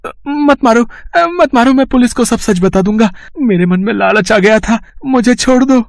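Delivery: high, desperate pleading not to be hit; begging in fear. Acted. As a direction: beg for mercy mid-beating. Thief begging while beaten.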